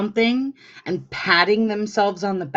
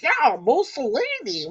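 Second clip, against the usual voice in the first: high, goofy voice, dragged out; mock dopey fan; 6 semitones higher than usual. Host mocking a Mussolini fan, high goofy voice. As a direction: play him as a clueless fanboy. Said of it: "he was like".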